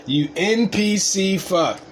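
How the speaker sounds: slow mocking insult. Real, not acted. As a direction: savour the insult, slowly. Mocking the NPC guy.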